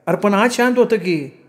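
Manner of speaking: high-pitched village mother objecting; protesting mother. A narrator's reading. Mother defending the dog to her son, protesting.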